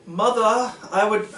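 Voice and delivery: a hammed-up Dutch child calling mother; mock Dutch accent. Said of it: "I don't know what a Dutch accent sounds like".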